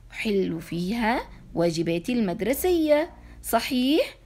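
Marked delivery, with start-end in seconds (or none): none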